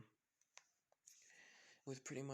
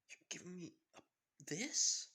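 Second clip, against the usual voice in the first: shocked disbelief; 6 semitones higher, 5 dB louder than usual. Acted. Izuku shocked at the bike.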